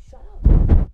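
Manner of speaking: loud, breathy shout at someone; loud fed-up outburst; exasperated shout. Real, not acted. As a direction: bark it out loud.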